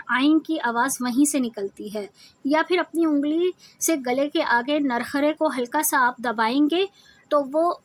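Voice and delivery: usual speaking voice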